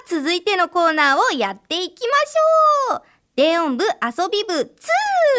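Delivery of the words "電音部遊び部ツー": high, slow, shouted title; title call. Acted. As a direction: belt out the corner name. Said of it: "corner title call".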